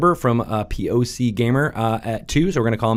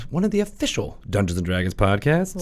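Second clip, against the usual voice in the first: ordinary delivery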